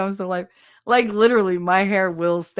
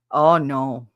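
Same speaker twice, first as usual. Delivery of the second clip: normal talking voice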